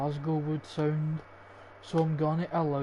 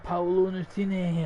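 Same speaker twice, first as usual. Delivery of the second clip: mock Italian accent; 3 semitones higher, 4 dB louder than usual. Gamer comparing the name to [name], playful Italian accent. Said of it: "He sounds Italian".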